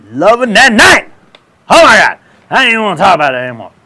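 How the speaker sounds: loud, high burst of mock passion; whooping, overblown relish; excited outburst. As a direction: explode from the hush into a high holler. Bursts out after hushed build.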